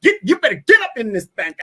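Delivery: high, loud hollered scolding; mock scolding outburst. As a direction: holler it like an exasperated caricature.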